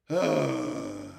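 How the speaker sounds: long, low exhaled sigh; weary sigh. Real, not acted. Venting fan gets it out of their system, weary sigh. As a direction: let out a long breath after venting. Winding down after rant.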